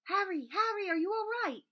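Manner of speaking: high and loud, calling out in worry; a girl running up, alarmed; anxious call. Acted. Hermione runs toward Harry and calls out, high and loud with worry.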